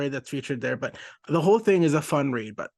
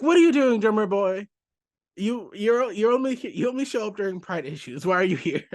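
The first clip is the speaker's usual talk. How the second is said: Bunker's indignant question; 6 semitones higher than usual. Retold or imitated. Bunker confronts Drummer Boy.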